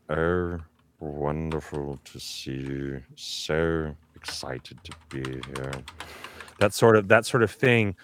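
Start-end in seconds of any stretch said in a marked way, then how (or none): 0.1-5.7 low, slow, soft drawling villain; slow and silky, deep and hushed; Alan Rickman voice. Retold or imitated. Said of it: "that Alan Rickman sort of".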